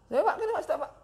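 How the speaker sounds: high, laughing embarrassed answer; high-pitched, embarrassed chuckle; awkward laughing reply. Retold or imitated. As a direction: laugh it off in front of the guest.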